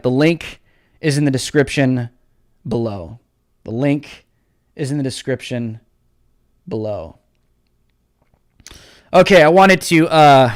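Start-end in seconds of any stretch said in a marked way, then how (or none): 0.0-3.2 fading plug for the link. Shown as a demonstration. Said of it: "repeated softer". 3.6-7.2 much lower, much softer, almost gone; fading echo of the link plug; fading to nothing. Shown as a demonstration. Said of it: "repeated softer".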